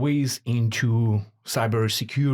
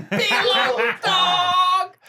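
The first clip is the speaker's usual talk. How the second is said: laughing; 4 dB louder, much slower than usual. Real, not acted. Laughing at the nickname.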